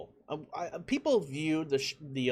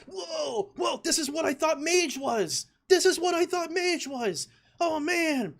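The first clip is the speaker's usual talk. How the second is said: much higher, louder, awestruck yelling; amazed shout; 5 dB louder, slower than usual. Retold or imitated. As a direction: shout like you just saw magic. Retelling first sight of Wind Fury.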